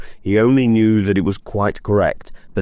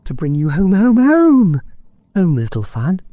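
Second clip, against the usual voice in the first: high joyful echo, louder on the middle home; young boy; 13 semitones higher, 4 dB louder than usual. Acted. Schoolboy echoing his sister's news, joyful. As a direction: repeat home with rising wonder. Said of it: "returned the boy".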